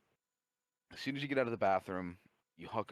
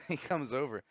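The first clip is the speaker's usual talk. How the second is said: ordinary talk, nothing marked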